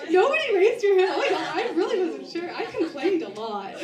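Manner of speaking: high, loud, talking through laughter; can't stop laughing while joking. Real, not acted. Teasing the audience about surviving, laughing loudly.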